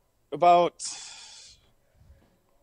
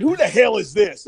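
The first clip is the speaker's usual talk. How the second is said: retold irritation; 6 semitones higher, 4 dB louder than usual. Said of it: "And I said".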